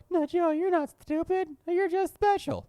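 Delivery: high, sugary mock-consoling voice; mock sympathetic listener. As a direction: coo it like a patronising fan. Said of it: "for those of you who are like".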